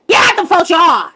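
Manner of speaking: loud, high-pitched holler; yelled out the window. Retold or imitated. Woman yelling out her window at people in a yard, holler. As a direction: holler it like it must carry across the yard. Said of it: "I yell it out the window".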